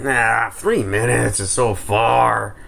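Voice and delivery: slow, dragged-out dismissal; lightly mocking laziness; mock lazy whine. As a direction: drag it out like a feeble excuse. Mocking own lazy excuse.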